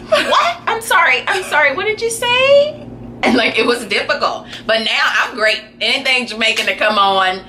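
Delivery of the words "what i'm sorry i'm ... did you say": high, baffled voice; mock confusion. Caller struggling with the parents' Jamaican accent, high bewildered asking. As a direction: ask it like you can't follow the accent at all. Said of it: "I was like".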